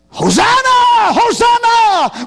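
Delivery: high, loud cry of hosanna; shouted high hosanna, drawn out; crowd's shout. Acted. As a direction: ring it out like a cheering crowd. Crowd cheering for the son.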